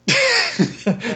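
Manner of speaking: a sharp, high gasp of shock from the crowd; collective gasp. Acted. The crowd gasps as the stranger denies knowing their god. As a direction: gasp as if the whole hall is stunned. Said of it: "There's a collective gasp by everyone around".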